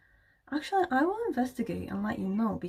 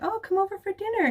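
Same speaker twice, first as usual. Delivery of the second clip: fake casual invite; 10 semitones higher, faster than usual. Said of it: "they're like".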